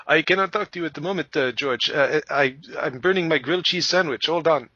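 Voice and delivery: lightly done bad Eastern European mafia accent, a bit fast; hammed-up Eastern European gangster, Count Dracula style; Jacques Vallée as mafia guy. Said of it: "my Jacques Vallée sounds like, you know, this bad Eastern European mafia guy".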